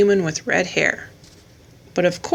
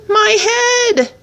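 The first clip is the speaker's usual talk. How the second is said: put-on high, loud whiny boy complaining of his head; hurt boy; 13 semitones higher, 4 dB louder than usual. A narrator's reading. Boy after the fall cries out in pain. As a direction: wail like a bumped kid. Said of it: "he groaned".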